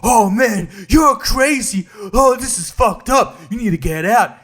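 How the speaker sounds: high, loud mock panic; mock freaked-out shout. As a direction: ham up a guy yelling in alarm. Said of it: "And the guy is like".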